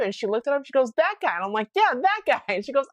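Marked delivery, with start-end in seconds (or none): 1.0-1.3 lightly voiced lady, high and louder; the friend spotting someone she knows; a hint of a thrilled Thai lady; excited recognition. Quoting the Thai lady's recognition. 1.8-2.4 high, louder, thrilled; retold excited yes; excited agreement. Her own excited reply, retold.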